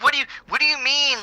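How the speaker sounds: high, fast disbelieving squeak; hammed-up high disbelief; incredulous mock voice. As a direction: act stunned in a quick high voice. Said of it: "It's like".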